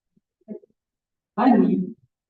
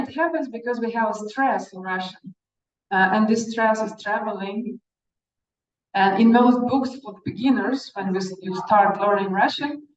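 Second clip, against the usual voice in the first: ordinary talk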